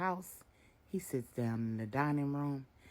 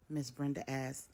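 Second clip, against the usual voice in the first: ordinary delivery, nothing marked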